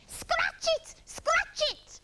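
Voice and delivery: very high, squawky chant; parrot squawk. A narrator's reading. Parrot to itchy Iggy, piercing squawk. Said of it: "squawked the parrot".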